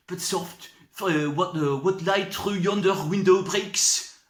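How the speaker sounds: Shakespeare read like a Frenchman trying English; French-accented English. Retold or imitated. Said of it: "i francesi che prova a parlare in inglese".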